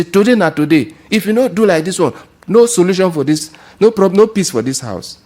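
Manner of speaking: high, loud ultimatum; wife threatening her husband at home; confrontational wife. Acted. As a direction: lay down the law to him. Wife with wrapper tied, issuing ultimatum.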